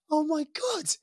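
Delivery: high, stunned gasp of disbelief; amazed child. Retold or imitated. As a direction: imitate a kid overwhelmed by a gift. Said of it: "he was like".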